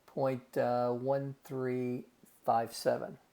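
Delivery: usual speaking voice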